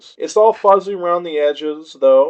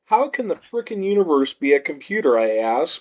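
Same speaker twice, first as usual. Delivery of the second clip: ordinary delivery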